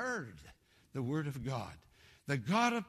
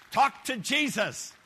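louder, slower preached command; a raised preaching call; loud slow exhortation; 6 semitones higher, 8 dB louder, slower than usual. Real, not acted. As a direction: drive it home to the congregation.

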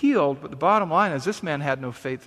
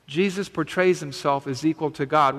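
ordinary delivery

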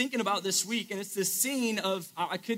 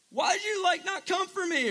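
much higher, hammed-up pleading cry; mock emotional plea; 10 semitones higher than usual. Allie yelling at Noah in the rain, mock emotional plea. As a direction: overplay her heartbreak on the dock. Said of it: "like, all emotional".

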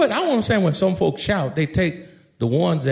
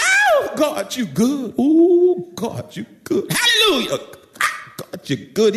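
ecstatic church shout; 10 semitones higher than usual. Acted. Shouting John praising God in church, acted ecstatic outburst. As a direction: whoop it like an overcome worshipper.

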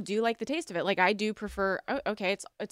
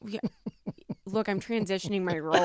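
usual speaking voice

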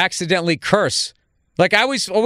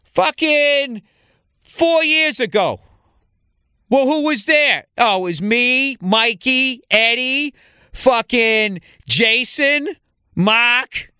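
higher-pitched, drawn-out Boston back-and-forth; Boston guy; 6 semitones higher, slower than usual. Acted. Comedian mimicking how Bostonians talk, exaggerated Boston accent. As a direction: play two Boston guys, stretching the pause words. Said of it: "That's how a Boston person would express themselves".

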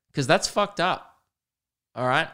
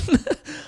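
high squeak of laughter. Real, not acted. Breaking up while retelling the run, a high squeak of laughter. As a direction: laugh bursts out high in the middle of a word.

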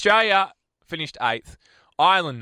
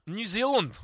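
mock New Zealand accent, pitched up; 7 semitones higher than usual. Radio host reading the accent rankings, mock New Zealand accent. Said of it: "can we not do accents".

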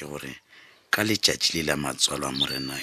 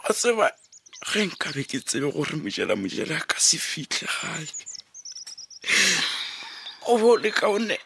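wailing grief. Acted. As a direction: cry out in despair, voice breaking. Father wailing over son's death.

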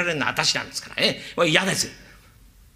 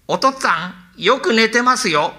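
the son's voice put on, a touch louder and higher; mimicking the son; 5 semitones higher, 4 dB louder than usual. Servant imitating the son to fool the father. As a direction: pass for the son calling home.

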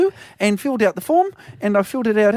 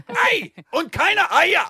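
a hammy mock German accent; German accent, lightly faked, stop-start; 4 semitones higher than usual. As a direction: rattle off half-remembered German words. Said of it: "gotta put on a German accent".

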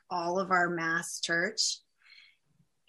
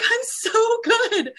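much higher, slow and strained, crying; tearful squeak; 7 dB louder, much slower than usual. Retold or imitated. Answering her worried brother while crying, high and slow. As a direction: force it out between sobs. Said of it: "I'm just crying hysterically".

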